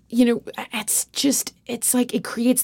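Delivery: breathy half-whisper, trailing off; half-whispered aside. Real, not acted. Admitting her patterns to a friend, in a half-whisper. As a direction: let it slip out under the breath.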